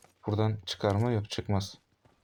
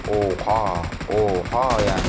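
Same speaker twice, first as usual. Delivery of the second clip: stunned oha; 2 semitones lower, 4 dB louder, slower than usual. Real, not acted. Shocked at loss.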